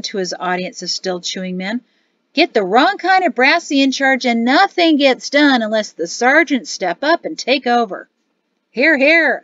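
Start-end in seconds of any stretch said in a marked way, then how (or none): 2.3-8.1 brash soldier ranting to his mates; a rowdy barracks sergeant, louder; loud boisterous sergeant. Acted. Boisterous sergeant holding forth. 8.7-9.4 mouth full. Acted. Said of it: "another sergeant garbled with a mouthful".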